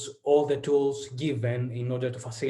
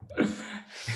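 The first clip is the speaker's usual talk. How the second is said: light breathy laugh; 8 semitones higher, 4 dB quieter than usual. Real, not acted. Laughs at thanks in advance.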